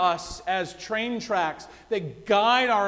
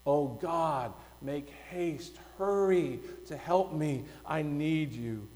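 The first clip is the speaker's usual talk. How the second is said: much softer, low, hushed plea; a soft, desperate prayer; desperate pleading; 5 semitones lower, 7 dB quieter than usual. Retold or imitated. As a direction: beg God in a hushed, broken voice. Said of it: "in a desperate pleading voice".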